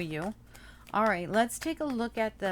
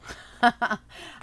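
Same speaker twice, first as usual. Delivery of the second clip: laugh; 5 semitones higher, 4 dB louder than usual. Real, not acted. Laughs at Virgo habits.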